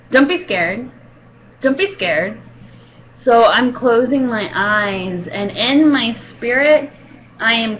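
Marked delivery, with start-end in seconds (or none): 0.1-2.4 a hint of a flat, offhand dentist; curt dentist. Dentist brushing off a trembling patient, lightly voiced. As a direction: toss off the words without care. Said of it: "the only comfort I got from this lady was".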